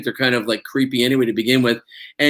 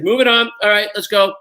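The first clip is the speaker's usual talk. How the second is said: higher, louder hurry-along; brisk crew voice; 9 semitones higher, 3 dB louder than usual. Retold or imitated. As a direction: chivvy everyone on to the next setup. Imitating crew rushing ahead.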